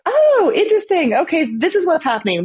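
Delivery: high, bright excited exclaim; excited body voice. Pretend. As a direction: voice the body, newly thrilled. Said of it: "our body's like".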